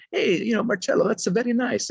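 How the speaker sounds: put-on high-pitched Italian mother's accent. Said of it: "in her Italian accent".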